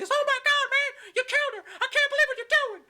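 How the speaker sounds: screaming, very high falsetto; hammed-up screeching panic; Jimmy Hart. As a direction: shriek in horror like a hysterical manager. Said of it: "fucking Jimmy Hart's screaming".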